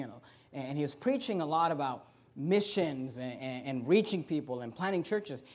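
ordinary delivery

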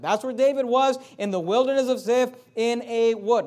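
loud, driving preacher's call; preaching at full force. Acted. Preacher pressing the congregation on David. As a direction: hammer the question home.

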